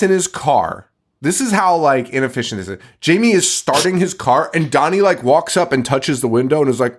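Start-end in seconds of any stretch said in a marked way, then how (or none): none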